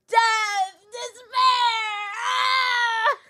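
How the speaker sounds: goofy despairing wail. Acted. Larry wails his doom to the lawyer, goofy despairing shouts. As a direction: wail it like a melodramatic wreck. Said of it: "I was playing this goofy".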